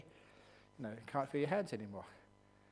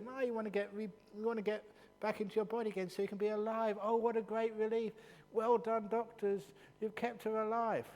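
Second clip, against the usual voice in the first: higher, sing-song mock praise of the doctors; mock relief; 9 semitones higher, 3 dB louder than usual. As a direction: parody the conventional view. Mocking what people usually think.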